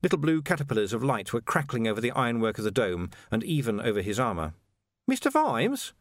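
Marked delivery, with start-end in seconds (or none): 5.1-5.9 high, chirpy villain with a laugh; mock innocence, grinning high; cheerful menace, high-pitched; Carcer's voice. A narrator's reading. Carcer calling sweetly.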